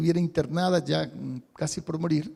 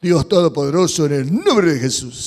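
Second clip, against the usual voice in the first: changed prayer voice; 2 semitones lower, 9 dB louder than usual. Shown as a demonstration. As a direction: put on a booming holy voice. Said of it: "cambiamos la voz".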